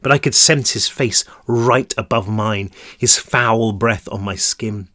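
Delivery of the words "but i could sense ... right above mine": ordinary delivery, nothing marked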